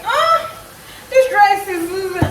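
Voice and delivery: lightly voiced husband, high start then drawn out; mock the husband's doubtful reaction. Husband eyeing her new dress, drawn-out mock doubt.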